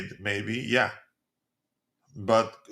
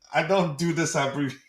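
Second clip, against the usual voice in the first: ordinary delivery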